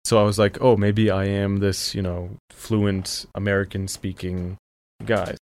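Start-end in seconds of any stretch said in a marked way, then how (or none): none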